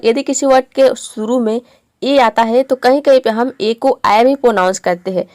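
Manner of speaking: ordinary talk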